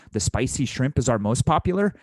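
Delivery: rising tune on "popular"; upward inflection. Shown as a demonstration. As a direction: lift the end of "most popular" like an enticing offer. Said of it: "you want an upward inflection in your voice".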